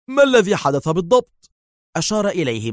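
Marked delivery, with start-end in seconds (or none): 0.1-1.5 high, loud, urgent question; eager. A narrator's reading. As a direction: demand the news eagerly. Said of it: "سأله دار في لهفة".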